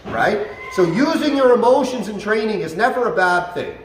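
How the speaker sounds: usual speaking voice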